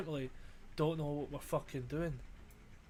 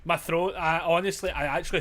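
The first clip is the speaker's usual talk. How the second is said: frazzled loud outburst; 4 semitones higher, 11 dB louder than usual. Real, not acted. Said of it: "frazzled my fucking titties".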